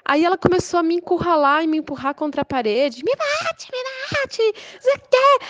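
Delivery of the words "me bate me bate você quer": caricatured provoking neighbor. Retold or imitated. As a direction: goad her shrilly. Neighbor pushing her against wall.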